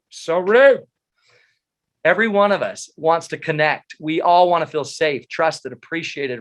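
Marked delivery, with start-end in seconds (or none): 0.1-0.9 mock indignant. Mock outrage at wife.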